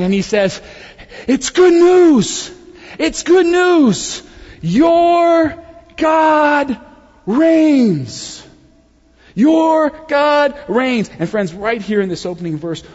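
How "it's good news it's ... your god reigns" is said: high, loud, drawn-out herald's cry; excited messenger's shout. Acted. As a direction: call it out to watchmen high on the walls. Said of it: "out of breath from running with excitement".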